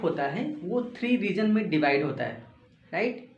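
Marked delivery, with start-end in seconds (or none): none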